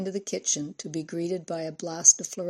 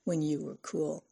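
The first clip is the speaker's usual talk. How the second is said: mocking laugh; 7 dB quieter than usual. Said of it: "She laughed".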